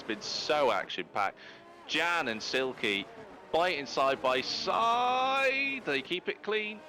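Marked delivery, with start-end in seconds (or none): 1.9-6.8 drawn-out, high-pitched SIDE by SIDE; excited race call. Real, not acted. Commentator calls two cars fighting side by side, excited. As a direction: stretch the battle call, pitch climbing high. Live call of close battle.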